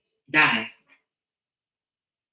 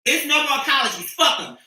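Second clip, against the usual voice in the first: ordinary talk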